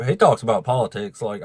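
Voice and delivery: ordinary delivery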